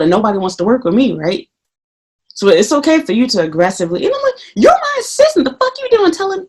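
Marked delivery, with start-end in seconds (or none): 4.6-6.5 high, indignant mock rant; mock outrage. Imagining telling off her assistant, high indignant mock outrage. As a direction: play the offended boss.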